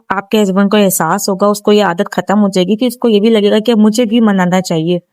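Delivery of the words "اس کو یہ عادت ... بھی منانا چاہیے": usual speaking voice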